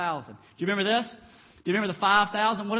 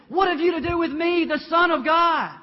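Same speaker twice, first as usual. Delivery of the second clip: louder, higher demon outcry; loud cry; 8 semitones higher, 8 dB louder than usual. Acted. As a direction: shout it up high as the demon. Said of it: "Crying out in a loud voice".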